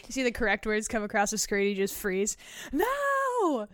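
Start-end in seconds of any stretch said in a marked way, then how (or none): 2.7-3.7 high, stretched shocked no. Retold or imitated. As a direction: cry out in disbelief. Freezing at karaoke.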